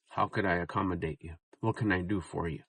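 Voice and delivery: tender, quiet service voice; soft accommodating husband. Shown as a demonstration. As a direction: offer help like a caring husband. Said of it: "I like that one better".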